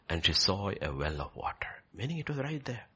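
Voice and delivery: hushed, breathy telling; whispered wonder. Real, not acted. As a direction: pull them in with a whisper. Preacher drops voice to whisper.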